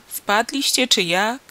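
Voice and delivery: drawn-out questioning holler; calling down. A narrator's reading. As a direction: call slowly down into a pit. Farmer calls down into pit.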